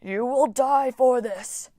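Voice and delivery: slow, otherworldly warbling vow; warbling threat. A narrator's reading. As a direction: drag out the threat as the machine fails. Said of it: "in a warbling voice".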